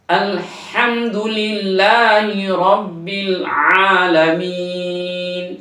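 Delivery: slow melodic tajweed chant; slow sung Quran recitation. Shown as a demonstration. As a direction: draw each word out, recite.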